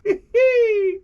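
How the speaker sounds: a big real laugh at his own joke; hearty laugh. Real, not acted. Laughing at his own joke to his viewers, real laugh. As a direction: burst out laughing, high and loud.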